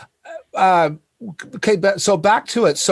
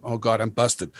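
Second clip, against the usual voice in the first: muttered panic; 8 semitones lower, 6 dB quieter than usual. Retold or imitated. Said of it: "I'm going".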